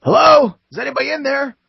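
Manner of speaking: high, loud call through a window. Acted. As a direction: holler to find anyone trapped inside. Guard calls into burning house.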